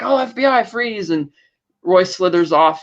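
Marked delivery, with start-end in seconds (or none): 0.0-1.1 mock police shout. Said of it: "they're like".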